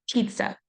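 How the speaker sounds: "pizza" with a strong puff of air on the P; puffed initial P. Shown as a demonstration. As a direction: demonstrate an overexpressed P burst starting "pizza". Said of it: "you can feel a puff of air".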